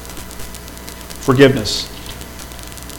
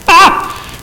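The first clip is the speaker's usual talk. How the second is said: mock exasperated cry.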